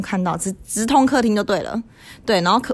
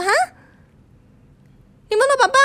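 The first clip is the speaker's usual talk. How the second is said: mother's voice jumps much higher and louder in disbelief; incredulous mother; 5 dB louder, much faster than usual. Retold or imitated. Mother doubting her small child's dream, sharply surprised and high. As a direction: mom can't believe her child dreamed of dad. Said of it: "我妈就一副不相信".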